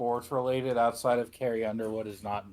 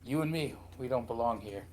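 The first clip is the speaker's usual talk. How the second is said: Skyrim intro voice; 2 semitones lower, 7 dB quieter than usual. Retold or imitated.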